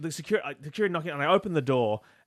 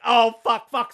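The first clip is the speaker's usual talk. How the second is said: retold scream; 6 semitones higher, 8 dB louder, slower than usual. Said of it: "So I'm, like, screaming".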